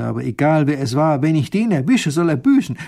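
loud, rising in pitch; loud, wrathful threat; angry threat. A narrator's reading. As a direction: vow revenge with mounting force. Ruler threatens the unknown culprit.

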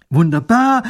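high, loud cry of joy; delighted. A narrator's reading. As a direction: burst out with delight, almost hopping. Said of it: "freut sich der Großherzog".

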